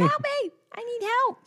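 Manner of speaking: high, hammed-up cry for help; imitating a panicked child; mock toddler wail. Mother imitating her daughter screaming alone. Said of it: "She's like".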